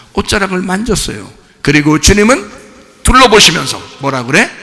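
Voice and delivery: usual speaking voice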